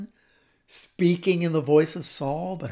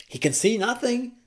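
ordinary talk

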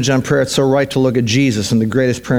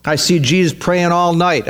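ordinary talk

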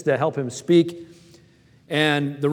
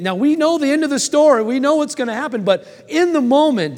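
fervent preaching rise; 10 semitones higher, 4 dB louder than usual. Acted. Preacher building to climax.

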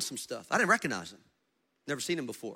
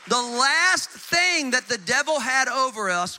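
loud, high, forceful declaration; full-force pulpit proclamation; impassioned preaching; 6 semitones higher, 8 dB louder than usual. Real, not acted. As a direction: preach it hard, drawing out key words.

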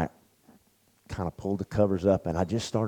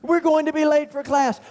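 much higher and a bit louder, frantic; hammed-up panic; 4 dB louder than usual. Roommate running in circles mocking anxiety, frantic panic. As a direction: shriek it like a cartoon worrier.

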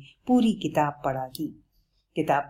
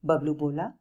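usual speaking voice

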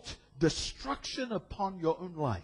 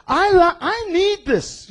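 much higher, loud cry of need; excited confession; 12 semitones higher, 7 dB louder, slower than usual. Acted. Preacher to people, high eager confession. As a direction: tell them I need this with urgency. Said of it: "I tell people".

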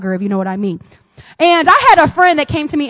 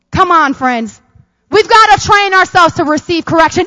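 ordinary delivery

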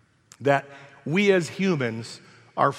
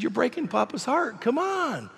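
put-on higher, softer coaxing baby talk; pleading with baby; 7 semitones higher, 4 dB quieter than usual. Grandpa pleads softly with his cranky grandbaby. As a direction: coax the baby gently. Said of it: "I said".

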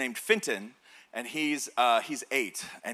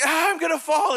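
high, loud mock whine; scared child; 11 semitones higher, 5 dB louder than usual. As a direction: imitate a kid afraid of dropping. Son afraid of falling.

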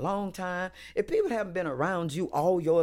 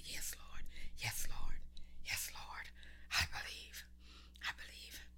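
whispered, repeated surrender to God; very soft, with a pause after each phrase; calm down; 13 dB quieter than usual. Shown as a demonstration. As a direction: breathe it like a private prayer. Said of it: "You just need to calm down and say".

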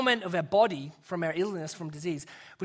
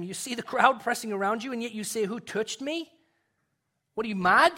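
higher, disbelieving question; acted disbelief, rising to a louder finish; incredulous; 4 semitones higher, 4 dB louder than usual. Disciples baffled by Jesus' question.

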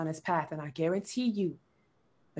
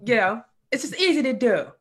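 breezy act, pitched up and louder; mock nonchalant; 5 semitones higher, 5 dB louder than usual. Guest mimicking how they talk about these things, mock nonchalant. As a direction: toss it off as if effortless. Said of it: "with, like, a nonchalant air".

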